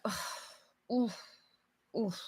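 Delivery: breathy mopey groans; moping groans. Shown as a demonstration. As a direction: groan like you're moping around. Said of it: "this kind of attitude".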